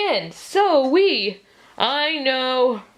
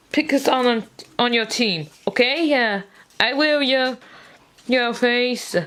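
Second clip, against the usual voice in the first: ordinary talk, nothing marked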